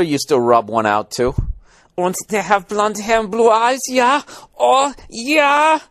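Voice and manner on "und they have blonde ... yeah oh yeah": high, excited cartoon German accent; mock German accent. Comic mimicking a lusting Hitler, high mock German accent. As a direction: squeal it in a hammed-up German accent.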